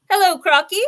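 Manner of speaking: high, loud greeting; cheeky monkey. A narrator's reading. Monkey in the tree hails the crocodile, high-pitched.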